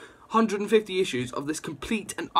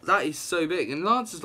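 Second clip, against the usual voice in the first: ordinary talk, nothing marked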